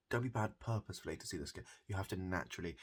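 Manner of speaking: normal talking voice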